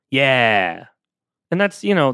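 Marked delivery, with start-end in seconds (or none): 0.1-0.9 an even, noncommittal yeah; neutral yeah. Shown as a demonstration. Host models the listener's answer with a neutral yeah. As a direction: answer without any feeling at all. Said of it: "that yeah is just a neutral yeah".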